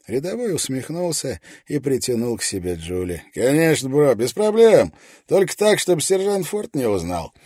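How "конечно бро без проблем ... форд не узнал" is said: loud, high, grinning bro; brash soldier grinning at his buddy; cocky bro soldier. A narrator's reading. (развязный солдат-бро)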